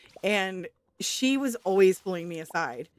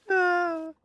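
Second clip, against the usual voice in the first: ordinary talk, nothing marked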